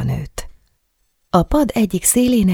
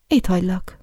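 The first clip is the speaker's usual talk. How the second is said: feigned calm; 4 semitones higher than usual. A narrator's reading. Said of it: "mondta a nő tettetett nyugalommal".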